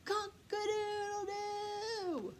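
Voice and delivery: high, slow sung rooster crow; full crow. Acted. Rooster with his voice back, crowing out. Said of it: "finally got his voice back".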